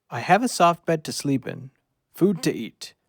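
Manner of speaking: plain reading voice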